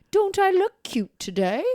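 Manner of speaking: high-pitched, badly faked English accent. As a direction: put on a cutesy amateur English accent. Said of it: "say it in an English accent".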